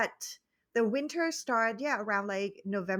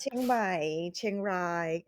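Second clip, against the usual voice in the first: Thai accent; slower than usual. Shown as a demonstration. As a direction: place names in a native Thai accent. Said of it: "I said it in Thai accent".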